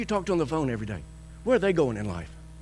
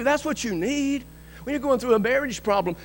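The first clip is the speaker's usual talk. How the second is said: ordinary talk, nothing marked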